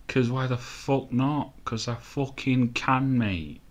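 put-on cocky, stretched Manchester accent. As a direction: go all Manchester, drawing the words out. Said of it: "Went all Manchester then".

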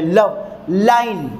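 लव, line slowly, leaning on each ल; slow demo, ल of लव and line stressed; stressing the ल sound in each word. Shown as a demonstration. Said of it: "ल के ऊपर stress है".

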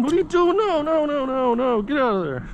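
ordinary talk, nothing marked

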